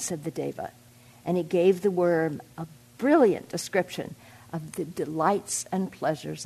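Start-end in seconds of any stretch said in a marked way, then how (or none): none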